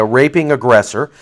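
ordinary talk

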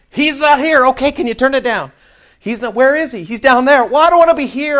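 high, loud and put out; fed-up newcomer. Pretend. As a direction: complain you want to leave. Dialogue voiced in heaven.

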